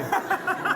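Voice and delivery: a quick high laugh at the memory. Real, not acted. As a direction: laugh at your own near-disaster. Laughs recalling the dead silence.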